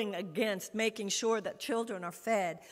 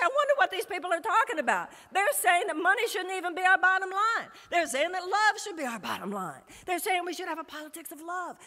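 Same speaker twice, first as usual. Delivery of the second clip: higher, faster voice of a baffled senator; mock senator; 7 semitones higher than usual. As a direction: play the puzzled senator, pitched up and quick. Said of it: "he'll say".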